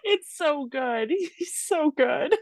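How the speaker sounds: high, slow, laughing delight; cracking up. Real, not acted. Delighting with cohost over DeVito's rocking, high and laughing. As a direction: gush through real laughter, drawing it out.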